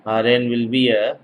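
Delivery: usual speaking voice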